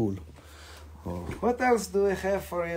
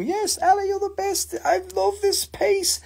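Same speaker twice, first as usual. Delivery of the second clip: squealing high; 3 dB louder than usual. Real, not acted. Thrilled thanks to buyer.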